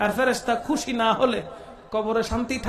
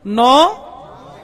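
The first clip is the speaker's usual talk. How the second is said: slow, louder call-back of নমাতেকা; crowd repeats loud; 7 dB louder, much slower than usual. Shown as a demonstration. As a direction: shout নমাতেকা back slowly. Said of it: "বলেন না". (শ্রোতাদের জোরে ধীরে নমাতেকা বলা)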